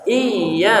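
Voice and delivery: Iya said with a throat ain, not plain iya; ain sound. Shown as a demonstration. Teacher correcting the student's pronunciation. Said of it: "bukan iya".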